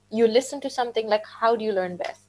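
ordinary delivery

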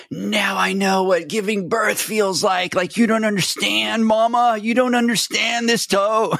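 high, whining complaint to Mama; mock a grown man whimpering like a toddler; husband whining to his wife about his toe; whiny baby voice. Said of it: "he's probably going to her".

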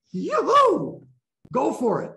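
high, bright cheer of encouragement; a cheer to someone letting out anger; cheering yoo-hoo. Real, not acted. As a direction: whoop them on gleefully. Cheering on an angry breather.

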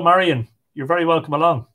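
plain reading voice

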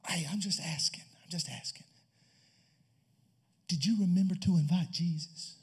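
soft tentative whisper; hushed whisper. Acted. Sister Mary whispering to the groom's father. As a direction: slip the question in quietly.

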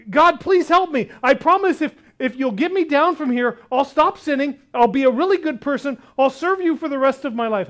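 loud, high, rushed begging; man on a branch pleading with God; desperate pleading. Acted. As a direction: beg for your life, bargaining. Jack bargains with God.

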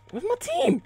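very high, loud shriek; exasperated shout. Real, not acted. Gamer left alone by his team, exasperated shout. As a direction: shriek the question in frustration.

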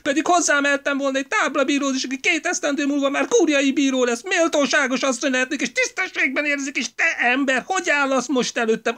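high, loud, relentless tirade; outraged wife berating her husband, high and loud; furious scolding wife. A narrator's reading. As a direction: tear into him without a breath.

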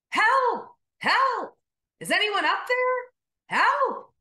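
high, loud calls for help; yelling for help. Acted. Jack dangling from a branch, shouting up for help. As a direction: shout up the cliff for rescue. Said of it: "So Jack began yelling for help".

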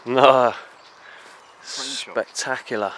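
loud amazed no, half shouted. Real, not acted. Explorer seeing the mansion, loud amazed outburst. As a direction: blurt it out in disbelief.

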